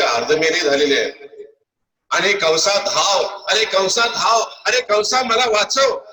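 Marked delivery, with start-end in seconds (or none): 2.1-6.0 high, drawn-out desperate call; crying out for help. Acted. Fleeing priest calls Kansa to save him, crying out. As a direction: cry out to be rescued while fleeing. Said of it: "अशी आरोळी देतच हे पळालेले".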